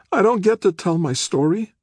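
very high, dismayed plea; pleading. A narrator's reading. Nick to the public defender, pleading. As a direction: plead it, pitched right up. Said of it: "his voice pleading".

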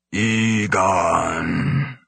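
drawn-out raspy gorilla voice; raspy, painful whisper. A narrator's reading. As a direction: strain the name out like an ape struggling to speak. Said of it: "Its voice was a raspy, painful whisper".

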